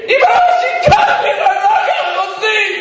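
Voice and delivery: very high, wailing protest; anguished screaming. Retold or imitated. Son fallen on mother's coffin, anguished screaming. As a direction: scream it at the very top of your range. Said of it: "והתחיל לצעוק".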